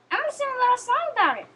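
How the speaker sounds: high, cutesy stuffed-toy voice; a shy plush guest's squeaky, eager voice; Cuddles the pillow pet. Acted. Plush guest announces song.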